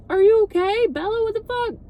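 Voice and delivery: high-pitched mock panic; panicked. Alice checking on Bella over the phone, mock panicked. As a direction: imitate frantic worry down a phone line. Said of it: "Weird that she sounded so panicked".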